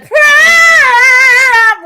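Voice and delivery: a high, loud, sung cat purr; imitated cat purr. Bragging to viewers about the cat's purr, high sung purr. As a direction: belt the purr like a cat showing off. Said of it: "that bitch fucked around and said".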